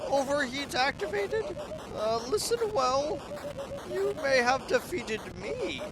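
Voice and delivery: a haughty machine monarch who lost but still gloats; robot queen voice. Acted.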